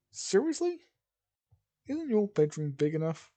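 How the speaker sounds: high, loud, incredulous complaint; exasperated. Acted. Belle complains to Rachel about his bed, exasperated.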